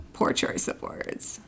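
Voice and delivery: a dry whispered remark; whispered aside. Real, not acted. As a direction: mutter it under your breath, deadpan.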